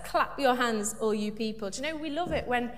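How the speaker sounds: ordinary talk, nothing marked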